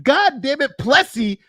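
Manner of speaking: high, loud ranting; heated rant. Real, not acted. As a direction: build an indignant rant. Ranting retold argument.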